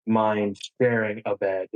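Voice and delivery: ordinary talk, nothing marked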